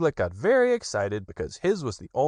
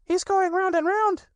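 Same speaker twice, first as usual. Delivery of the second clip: put-on very high, eager child voice; little Roo chattering; 3 dB louder than usual. A narrator's reading. Little Roo pesters his friends about their sticks, high and excited. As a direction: tumble the questions out like an impatient little one. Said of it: "said Roo, much impressed".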